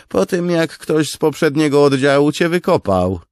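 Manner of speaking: high, loud mocking soldier; deadpan jab. Tall soldier mocking Beard's tall tale, high loud jab. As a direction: puncture his story. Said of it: "stwierdził wysoki żołnierz".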